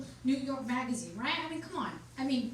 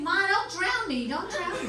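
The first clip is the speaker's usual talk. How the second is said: put-on high, slow pleading child wail; hammed-up little kids begging; mock kids screaming; 7 semitones higher, 8 dB louder, slower than usual. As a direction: wail like a terrified kid. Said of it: "they're screaming".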